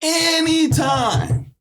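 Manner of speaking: long stretched high call; stretched-out call of anytime; drawn-out call. Retold or imitated.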